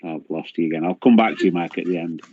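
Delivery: normal talking voice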